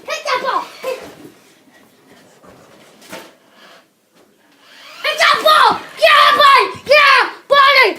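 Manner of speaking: high, loud shouted cheer; excited cheering. Real, not acted. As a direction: roar him on to hit it. Cheering the RC car hit.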